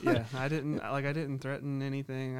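ordinary talk, nothing marked

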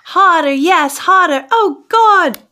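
excited yell. Acted. [name] yelling with enthusiasm.

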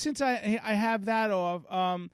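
high-pitched hesitant mechanic; imitated mechanic. Acts out the mechanic replying.

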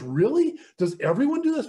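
high, loud, disbelieving questions; retold incredulity. Newcomer asks his mentor about another nine months, incredulous. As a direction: imitate an astonished newcomer.

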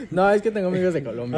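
loud laughing reply. Real, not acted. Caught out about Narcos, laughing loudly. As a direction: laugh through the excuse. Laughing after being teased.

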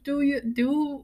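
put-on high, slow groping for a Scottish accent; attempted Scottish accent. Friend tries out the helper's offer, hesitant Scottish accent. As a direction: feel slowly for the Scottish vowels. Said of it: "he was Scottish".

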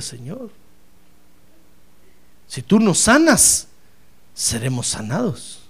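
soft, hushed prayer; quiet prayer. Shown as a demonstration. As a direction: pray it softly for your brother. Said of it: "mírelo y dígale". (oración suave y en voz baja)

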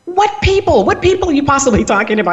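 high-pitched mock disbelief; incredulous. Wife retells challenging her husband, incredulous. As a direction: challenge him, pitch jumping up then easing.

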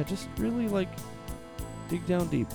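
ordinary delivery

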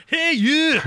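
high, shouted Scottish challenge; Scottish accent. Acted. Elderly Scottish lady confronting a man on a train, loud Scottish accent. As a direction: bellow it as a hard Scottish lady. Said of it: "I'm gonna do the accent".

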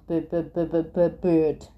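repeated stammer on "be"; stuttering. A narrator's reading. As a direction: get stuck on the b before the last word. Acted stutter on be. (stotteren op de b)

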